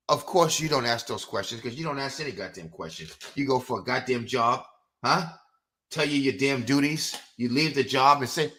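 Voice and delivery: ordinary delivery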